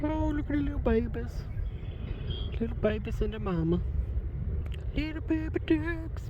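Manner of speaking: real high-pitched adoration; adoring cooing. Real, not acted. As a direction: coo at tiny animals up high. Cooing at baby ducks.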